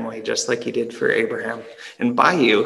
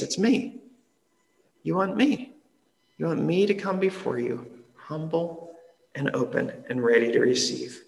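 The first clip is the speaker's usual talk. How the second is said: tender voice of God; 2 semitones higher, 7 dB quieter than usual. Acted.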